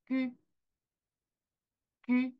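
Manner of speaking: 퀴 with rounded lips held still; French u. Shown as a demonstration. Teacher models the French u sound. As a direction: keep lips round and unchanging on 퀴. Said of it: "이렇게요".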